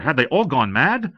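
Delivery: plain reading voice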